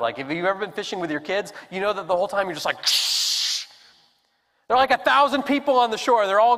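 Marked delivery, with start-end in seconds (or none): 2.9-3.6 unvoiced shush; hushing. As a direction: hush them like fishing with kids. Hushing kids while fishing.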